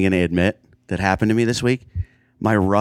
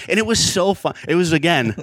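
normal talking voice

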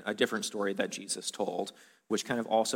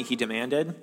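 ordinary delivery

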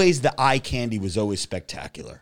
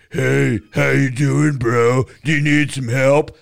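lightly voiced big bro clerk, slow and louder; hammed-up jock salesman greeting, slow and louder; mocking bro clerk; 7 dB louder, slower than usual. Mocking a linebacker-type Sephora clerk, slow loud bro greeting. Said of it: "there were dudes that were like".

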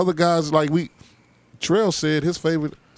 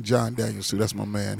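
normal talking voice

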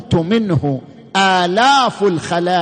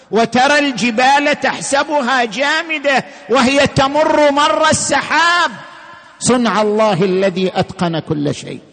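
Quranic recitation; 4 semitones higher, 4 dB louder than usual. Acted. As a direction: intone the verse like Quran recitation. Quran verse recited in chant.

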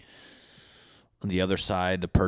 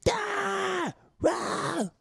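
very high mock shrieks; high scream. Feuding neighbor screaming about tree limbs, very high shriek.

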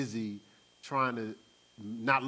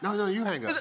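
playful high sweet-talk; giggly late night call; 7 semitones higher, 3 dB louder than usual. Retold or imitated. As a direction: coo it like a teenage crush. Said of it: "Be like".

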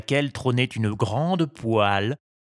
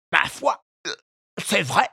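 grudging fisherman; 8 semitones higher than usual. A narrator's reading. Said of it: "admit le pêcheur".

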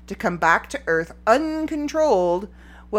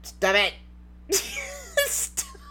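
squeaky laugh; 4 dB quieter, slower than usual. Real, not acted. Trying to stop laughing.

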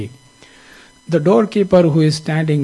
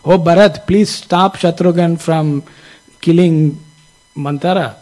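higher, louder plea for help; raised-voice pleading; appealing; 4 semitones higher, 5 dB louder than usual. Acted. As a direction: appeal urgently, pushing the first words out. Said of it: "So she appeals to him".

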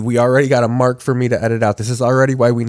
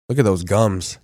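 ordinary delivery

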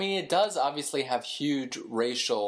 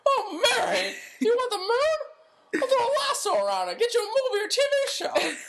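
hammed-up, very high lovestruck hero; crying out in a squeaky impression; George Bailey. Co-host offering the moon to Mary, hammed-up impression. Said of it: "That's the one impression that I think".

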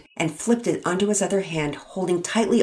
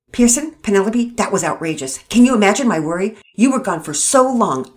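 loud, sputtering scolding; angry dressing down; 5 semitones higher, 8 dB louder than usual. Acted. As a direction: chew them out, furious and flustered. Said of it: "Mama Peters sputtered".

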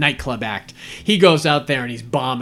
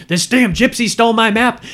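higher, louder hammed-up pirate complaint; hammed-up higher angry pirate; mock villain; 9 semitones higher, 5 dB louder than usual. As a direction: play the angry pirate captain. The Hook's line acted out.

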